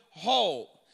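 "whole" set apart as one weighty, falling word; emphatic whole. Shown as a demonstration. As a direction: say "whole" alone, low and deliberate. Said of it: "I want to emphasize that word".

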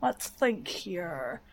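slow, musing scheming voice; pondering Vex mind. Acted. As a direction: think it over slowly like a plotting machine. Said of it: "That's where the Vex are like".

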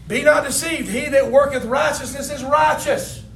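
loud, high, with a shout in it; fiery sermon climax; full-force preaching. Acted. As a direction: preach it to the rafters.

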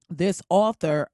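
usual speaking voice

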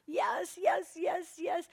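high breathy delighted yeses, fading down; Holy Spirit answering praise, airy and high. Acted. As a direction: let the Spirit cheer in an airy whisper. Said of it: "the Holy Spirit's like".

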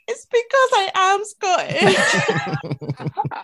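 high, drawn-out comic wail; mock-wounded protest. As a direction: wail it slowly and loftily through a laugh. Retold hurt protest, half laughing.

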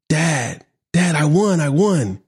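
put-on excited child. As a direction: mimic a little girl bursting with joy. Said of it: "she's all excited".

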